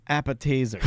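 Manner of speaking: "appetizers" said as appe-teasers; playful mispronunciation. Shown as a demonstration. Host corrects the guest's word, "appetizers" said as appe-teasers. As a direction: say "appetizers" with "teasers" in place of "tizers". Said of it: "I like to call them".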